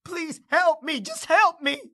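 desperate pleading. Acted.

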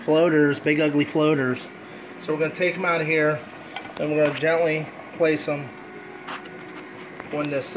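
ordinary talk

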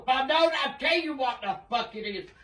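usual speaking voice

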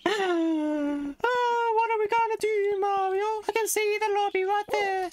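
very high squeaky lament; mock whining. Gamer asks Mario what to do, squeaky mock despair.

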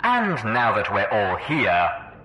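slow, assured villain over loudspeakers; unhurried announcement through many speakers; Red Eye. A narrator's reading. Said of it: "Red Eye's voice floated out of a dozen speakers".

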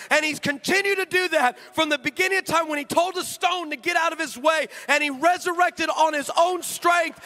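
preaching climax. Acted.

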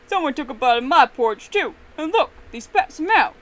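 loud outraged screech, rising at the end. A narrator's reading. Papa Bear finding his porridge tasted, screeching. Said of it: "Papa Bear screeched".

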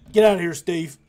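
shouting after someone. Pretend. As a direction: yell it after him as he leaves. Yelling after departing game character.

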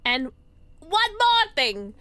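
slow, exaggerated dramatic announcement; hammed-up repeat. Hammed-up repeat of game line.

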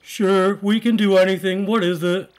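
loud, high, eager game character; funny voice. Acted. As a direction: answer eagerly in a cartoon voice. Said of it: "I wanna do some funny voices".

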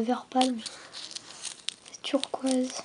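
breathy unvoiced whisper of delight; soft hushed aside. Real, not acted. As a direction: whisper it to yourself, charmed. Whispered aside while unboxing.

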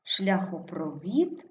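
Шляхопровід slowly, stressed шляхопро-ВІД; stress on fourth syllable. Shown as a demonstration. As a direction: stretch the final ВІД in Шляхопровід. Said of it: "Наголос падає на І". (Шляхопровід повільно, з наголосом на ВІД)

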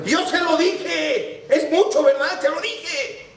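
very high mock yell; shouting. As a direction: wail it high, stretching the words. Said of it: "le vuelve a gritar".

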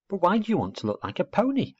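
put-on high, curious young voice; puzzled owlet. Owlet asking girl about her ponytail, puzzled. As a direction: ask in childlike bafflement.

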